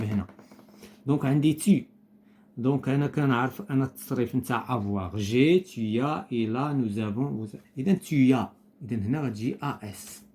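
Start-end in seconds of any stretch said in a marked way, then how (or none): 5.2-8.5 slow conjugation. Shown as a demonstration. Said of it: "teacher conjugates slowly for pupils".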